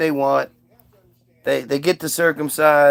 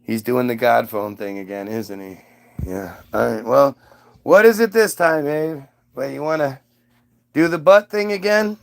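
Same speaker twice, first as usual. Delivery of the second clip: weary slaves; 5 semitones lower than usual. Acted. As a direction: grumble like fed-up servants. Slaves groan at Abe's news.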